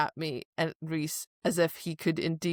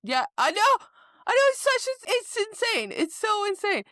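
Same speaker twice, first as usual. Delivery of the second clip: much higher and louder, worked up; excited outrage; 5 dB louder than usual. Real, not acted. Agreeing with her co-host about the plot, excited high outburst. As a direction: burst out in disbelief.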